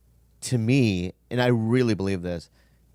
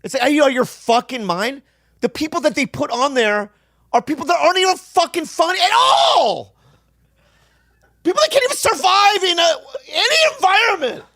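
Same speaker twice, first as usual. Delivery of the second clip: building from a heated rant to a high, cracking shout; furious shout with a laughing crack at the top; angry shouting; 13 semitones higher, 4 dB louder than usual. Real, not acted. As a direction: let it boil over until you're yelling, half laughing. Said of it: "I'm fucking tired of it".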